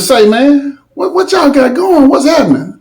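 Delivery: imitating himself asking what's up; retold question. Narrator asking the little dude what's going on, voiced high.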